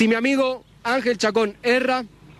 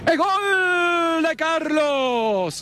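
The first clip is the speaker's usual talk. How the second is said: very slow, higher drawn-out goal; long goal cry; 5 semitones higher, much slower than usual. Acted. Commentator calling Carlos's goal, very drawn-out cry. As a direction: hold the goal like a radio caller.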